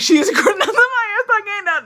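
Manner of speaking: high, loud laughing burst; words breaking up into high laughter; cracking up. Real, not acted. Storyteller cracks up over a wild family scene, laughing.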